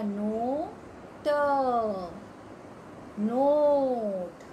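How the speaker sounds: नोट drawn out very slowly, each sound clear; slow drawn-out word reading. Shown as a demonstration. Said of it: "न को ओ की मात्रा".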